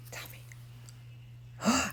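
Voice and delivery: soft breathless whisper of tell me. Real, not acted. Host to the puppet, coaxing it, in a whisper. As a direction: lean in and whisper it like a secret.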